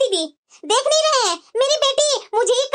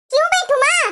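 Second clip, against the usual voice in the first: petulant daughter; 6 semitones higher than usual. Acted. Daughter refusing her mother's order to sit, petulant whine. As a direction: refuse like a sulky grown child.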